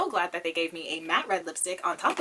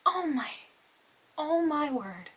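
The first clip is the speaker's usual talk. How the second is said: higher, falling sighs of wonder; awed exclamation; 6 semitones higher, slower than usual. Real, not acted. Reviewer gazing at the new palette, high awed wonder. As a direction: let each exclamation sink in amazement.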